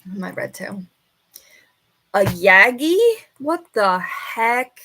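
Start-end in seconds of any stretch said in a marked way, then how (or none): 2.1-4.8 slow, loud, puzzled disbelief; baffled surprise. Real, not acted. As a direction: drag out your confusion. Baffled by an unknown term.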